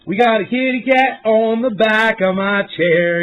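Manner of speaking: ordinary talk, nothing marked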